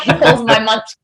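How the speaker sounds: ordinary delivery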